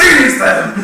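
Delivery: high, loud rallying shout; battle cry. Retold or imitated. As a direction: yell the order to charge. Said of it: "I was like".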